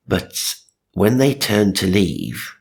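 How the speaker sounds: ordinary talk, nothing marked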